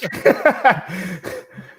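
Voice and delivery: loud burst of laughing; real laughter. Real, not acted. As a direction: crack up out loud. Amused by chat message.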